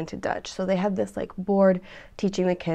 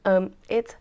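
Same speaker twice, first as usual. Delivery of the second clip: ordinary talk, nothing marked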